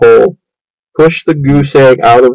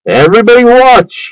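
much higher eager call to the crowd; excited cry; 10 semitones higher than usual. A narrator's reading. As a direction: announce it like a proud showman. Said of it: "he cried".

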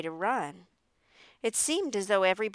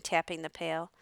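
ordinary delivery, nothing marked

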